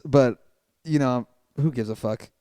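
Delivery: ordinary talk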